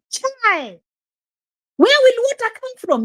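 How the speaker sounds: pitched well up, scandalised question; incredulous high outcry. Real, not acted. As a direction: sound scandalised at the idea. Disbelief at the village pool.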